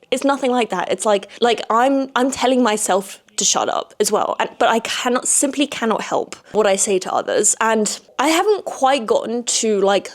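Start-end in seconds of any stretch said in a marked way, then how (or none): none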